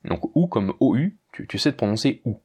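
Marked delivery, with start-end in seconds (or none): none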